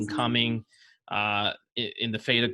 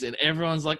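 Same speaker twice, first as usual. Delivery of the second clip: ordinary talk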